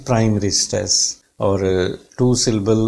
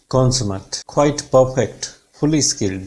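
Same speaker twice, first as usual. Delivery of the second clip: ordinary delivery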